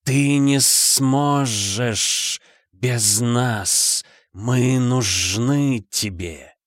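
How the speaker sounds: very slow hissing demon voice; drawn-out hissing serpent; demonic whisper. Acted.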